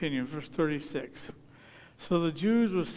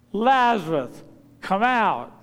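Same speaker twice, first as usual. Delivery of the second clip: loud, higher shout for all to hear; loud cry; 5 semitones higher, 10 dB louder, slower than usual. Acted. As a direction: call out so everybody there hears. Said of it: "so that everybody that was there heard".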